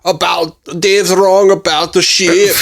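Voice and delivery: mock Scot griping about Dave; bad Scottish accent. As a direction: keep the terrible Glasgow voice going. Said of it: "the worst impersonation I could possibly do".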